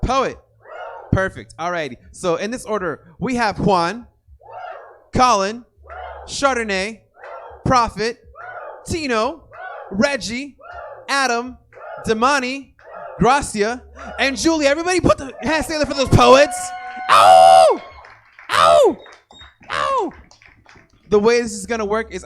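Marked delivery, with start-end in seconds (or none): 0.0-0.4 loud, higher announcer call; hype call. Shown as a demonstration. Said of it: "let's practice". 3.2-14.7 loud, high roll call; hype announcer. Real, not acted. 14.7-16.6 very loud, fast, higher hype call; hype shout. Real, not acted. Hyping crowd. 17.1-20.1 hype yelps. Real, not acted.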